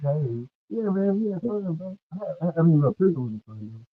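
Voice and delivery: muffled, like through a wall; dull, muffled mic sound. Real, not acted. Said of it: "you muffled".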